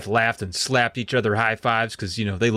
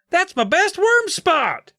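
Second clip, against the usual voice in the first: high, slow, whiny protest; a kid guarding his turf, whining; slower than usual. Acted. Todd voiced protective of spot.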